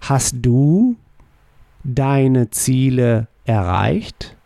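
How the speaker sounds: slow German question with rising end; slow model question. Shown as a demonstration. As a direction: ask it slowly, lifting at the end. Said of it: "How would you ask".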